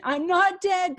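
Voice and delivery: father yelling to his frightened daughter; retold yell. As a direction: shout it to reach a daughter in shock. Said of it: "he was yelling at her".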